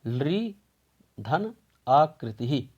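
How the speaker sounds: धन आकृति ही said slowly, word by word; slow teacher dictation; slow careful split. Shown as a demonstration. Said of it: "इसकी संधि विच्छेद हम करेंगे".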